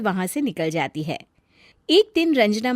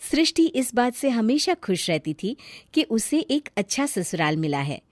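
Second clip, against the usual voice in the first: plain reading voice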